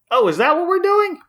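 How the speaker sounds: high-pitched sarcastic surprise; mock realization. Mocking Batman's obvious order to Robin, high mock surprise. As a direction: play dumb, pitch leaping way up.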